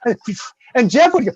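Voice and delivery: ordinary talk, nothing marked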